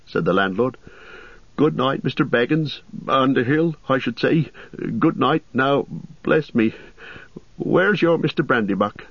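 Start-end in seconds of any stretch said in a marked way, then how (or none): 1.6-9.0 higher, fussy innkeeper voice; innkeeper Butterbur. Acted. Landlord gossiping to Frodo about the ranger, raised fussy voice. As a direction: chatter like a nervous landlord.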